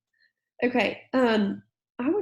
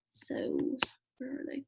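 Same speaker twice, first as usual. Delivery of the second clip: whispering; 2 semitones higher, 10 dB quieter than usual. Real, not acted. Searching the page, whispered aside.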